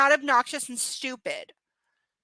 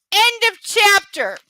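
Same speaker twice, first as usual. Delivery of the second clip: much louder and higher, slow and triumphant; excited announcement; 7 semitones higher, 10 dB louder, slower than usual. Real, not acted. Host tells the stream the chapter is done, excitedly loud. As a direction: throw it out like a victory cry.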